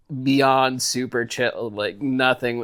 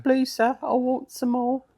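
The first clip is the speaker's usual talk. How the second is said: high pleading street-urchin voice; pitched way up, pleading for more gruel; begging orphan boy; 12 semitones higher than usual. Pretend. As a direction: beg for more like a hungry orphan. Oliver Twist begging quote.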